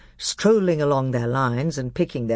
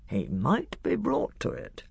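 softer, guarded murmur; lowered voice; 9 semitones higher, 5 dB quieter than usual. A narrator's reading. Lord hinting to his fellow lord about the king, lowered voice. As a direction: drop the volume as if broaching something secret. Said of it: "in a much lower voice".

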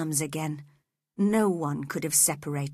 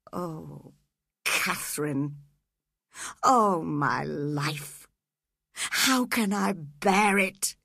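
anguished cry; slower than usual. Acted. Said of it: "he cried".

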